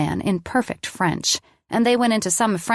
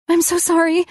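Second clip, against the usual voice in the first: high, louder, breaking tearful apology; shaking apology; 11 semitones higher, 4 dB louder than usual. Acted. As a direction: plead sorry again, voice cracking. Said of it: "my body shaking".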